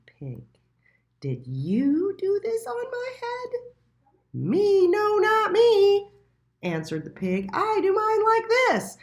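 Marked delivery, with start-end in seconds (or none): 1.2-3.7 high, loud squeaky question; a small indignant mole demanding answers; little mole voice. A narrator's reading. Little Mole asking the pigeon, high and indignant. 4.3-6.1 high, loud, slow denial; a fat pig squealing innocence. A narrator's reading. The pig denying it to Little Mole, high and loud. 7.5-9.0 high and loud, proud; squealing it out; pig voice. A narrator's reading. The pig showing Little Mole its way, high and loud.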